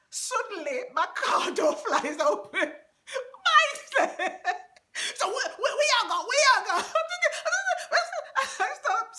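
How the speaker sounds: high, loud, fast laughing retelling. Real, not acted. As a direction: laugh through the shock of the door flying open. Door flies open, laughing retelling.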